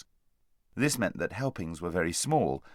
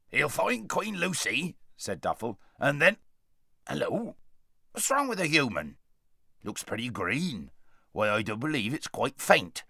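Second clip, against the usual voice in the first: raised dwarf voice turning concerned; a gruff helper noticing the faint boy; kindly dwarf; 4 semitones higher than usual. Acted.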